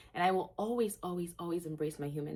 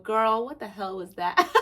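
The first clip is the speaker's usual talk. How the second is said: louder, laughing at herself; real laughter, half crying; 3 semitones higher, 8 dB louder than usual. Real, not acted. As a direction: crack up at how silly she sounds. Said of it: "Now I'm laughing".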